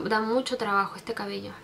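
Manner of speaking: usual speaking voice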